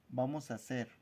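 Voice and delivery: normal talking voice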